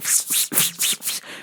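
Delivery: quick voiceless puffs of breath; imitated hissing noise. Woman off in the bushes, soft imitated puffing. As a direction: make soft rhythmic hissing bursts.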